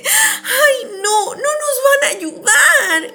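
very high, drawn-out wail; hammed-up tearful dismay; worried voice. A narrator's reading. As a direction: whine it like a worried cartoon hero. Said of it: "con una voz preocupada".

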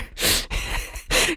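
soft unvoiced giggle; breathy laugh. Real, not acted. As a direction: laugh quietly at the stumble. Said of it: "hard to get my mouth around".

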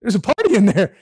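high, eager coaxing father; excited father begging his son to join the party; father pleading. Retold or imitated. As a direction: coax the sulking son inside, bubbling over. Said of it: "and say".

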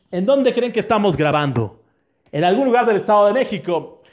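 ordinary delivery

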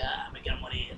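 mucho más grave y suave, agotada; profesora harta. Retold or imitated. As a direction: suspiro de maestra sin ganas de vivir. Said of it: "las profesoras como de que". (maestra cansada del primer día, grave y apagada)

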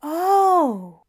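high, loud breathless oh; breathless gasp. A narrator's reading. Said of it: "He sounded breathless already".

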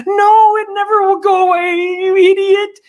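mocking leftists shrieking at Trump. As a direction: screech like a furious protester. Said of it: "the radical left shrieked".

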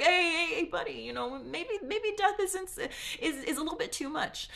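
the praetor anxiously pleading with his boss; nervous backpedaling. Retold or imitated. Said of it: "And the praetor's like".

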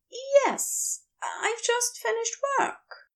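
bright, eager reply; high, gentle Aziraphale; Aziraphale's voice. Acted.

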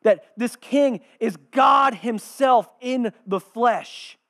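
slow, loud, high declaration; emphatic preaching. Real, not acted. Preacher revealing who the king is, emphatic shout. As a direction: hammer each word home at full voice. Preacher drives home his climax.

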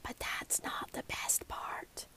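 soft unvoiced whisper, slow. A narrator's reading. Girl sharing a secret with Grandma, whispered. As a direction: breathe it out like a secret. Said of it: "before whispering".

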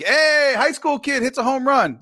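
high, loud mock announcement; high-pitched teasing holler; heckling call-out. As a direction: call it out across the ballfield. Retold heckle at the ballgame.

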